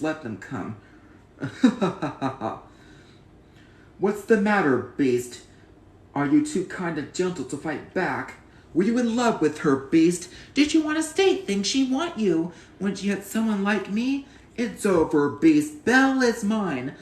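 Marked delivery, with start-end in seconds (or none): 1.4-2.6 villain's mocking laugh. Villain laughs in recited film. 4.0-16.9 loud, swaggering villain taunts; mock the beast from above; boastful brute jeering his rival; taunting villain.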